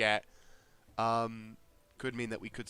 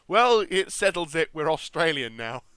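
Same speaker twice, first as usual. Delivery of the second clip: loud, high, hammed-up Aussie; terrible Australian accent; 6 semitones higher, 13 dB louder than usual. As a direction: ham it up as a bad Australian. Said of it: "my terrible Australian accent".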